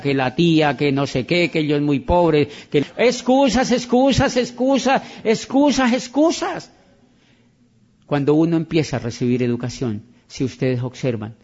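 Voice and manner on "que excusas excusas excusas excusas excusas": each excusas higher and harder than the last; building repetition. Acted. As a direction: hammer excusas, climbing in pitch. Mocking list of excuses, building.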